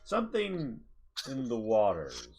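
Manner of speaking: ominous narration. Acted. GM dramatic scene narration.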